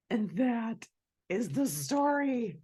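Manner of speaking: slow, grand closing line; storybook ending. A narrator's reading. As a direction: wrap it up like a fairy tale.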